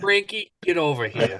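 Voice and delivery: calling out in a hammed-up Boston accent. Agent calling the manager over, mock Boston accent. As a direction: summon a colleague, mock Boston style. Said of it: "because of the dialect or the accent".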